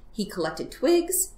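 ordinary delivery

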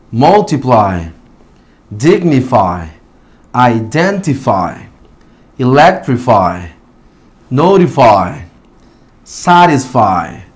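multiply, dignify, identify, electrify, notify, satisfy slowly, ending on falling "eye"; long I sound. Shown as a demonstration. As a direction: review list, one slow word at a time, final Y as "ai". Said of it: "vamos a pronunciarlas nuevamente". (multiply, dignify, identify, electrify, notify, satisfy lentos, con final "ai")

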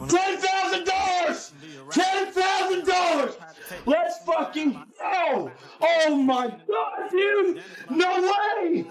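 very high-pitched, elated cries; real ecstatic shouting. Real, not acted. Streamer hitting ten thousand dollars, ecstatic. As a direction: cry out high in disbelief at a jackpot.

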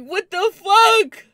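screaming disbelief; exasperated shout. Retold or imitated. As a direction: wail it at the top of your voice. Outraged friends went back.

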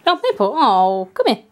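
usual speaking voice

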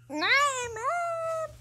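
put-on whiny kid. Child whining to mother.

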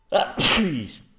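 sudden loud sneeze; a real sneeze bursting out; sneezing. Real, not acted. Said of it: "creo que voy a estornudar". (estornudo fuerte y repentino)

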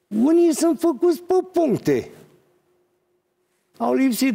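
loud, high-pitched exclamation; indignant outburst. Real, not acted. Surgeon decrying colleagues who skipped practical training, indignant outburst.

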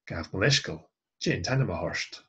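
"J and Hanum aha orst" run together fast as one flowing phrase. Shown as a demonstration. Teacher showing learners the run-together form, fast and blended. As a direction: blend the words quickly, vowels running into each other. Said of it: "So this is run together".

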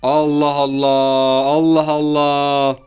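slow, stretched out, weary; a long, drawn-out "Allah Allah"; exasperated Allah Allah. Real, not acted. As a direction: drag it out in disbelief. Internet keeps dropping, exasperated.

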